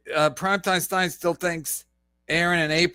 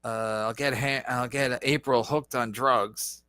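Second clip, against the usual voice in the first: lower, mock scheming voice; imitating Aaron; 7 semitones lower than usual. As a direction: voice him as a sly schemer. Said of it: "Maybe he's like".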